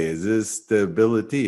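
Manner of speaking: light imitation of a French accent. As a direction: mimic a French central banker's accent. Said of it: "in his French accent".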